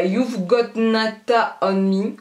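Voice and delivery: slow, put-on English accent on the polish name; hammed-up English accent for the polish names; mock English accent. Reading polish names to viewers in a mock English accent. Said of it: "mon accent, il est trop bien".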